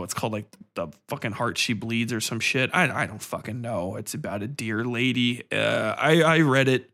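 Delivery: ordinary talk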